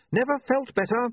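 high, panicky wail; desperate high-pitched protest. A narrator's reading. William still wailing, fending off Nobbs.

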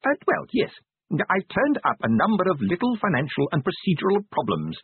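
high-pitched, unruffled clerk; mild-mannered bureaucrat, pitched high; calm little official. A narrator's reading. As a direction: explain evenly in a high voice. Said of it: "said A E Passimo calmly".

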